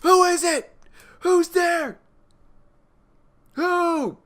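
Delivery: high, loud panicky calling into the dark; hammed-up scared man shouting who's there; mock frightened yelling. Neil wakes and calls out at the shadow, high panicked yell.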